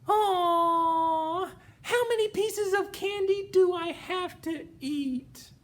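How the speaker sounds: put-on high, loud whining child; whiny kid. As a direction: whine like a crying toddler. Little P whining about candy.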